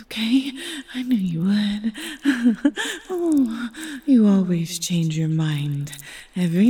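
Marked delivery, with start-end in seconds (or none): none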